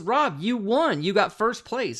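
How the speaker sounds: high, excited announcement; excited kid shouting. Retold or imitated. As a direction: burst out with the big news. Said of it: "he goes".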